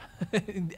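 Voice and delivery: a quick real laugh breaking into the quote; short laugh. Real, not acted. Pastor laughing while retelling the blunt church interruption, short laugh.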